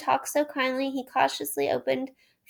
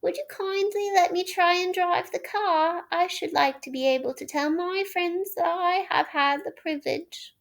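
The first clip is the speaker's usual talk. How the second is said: washerwoman voice; 7 semitones higher than usual. A narrator's reading. Toad as washerwoman.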